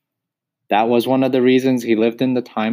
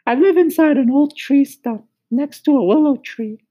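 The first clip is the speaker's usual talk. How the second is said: very high, sing-song cricket telling his story; much higher, lilting cricket voice, storytelling; high musical cricket; 4 dB louder than usual. Acted. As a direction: chirp the tale like a tiny cricket.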